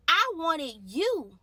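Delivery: preaching. Real, not acted. Preaching at full force.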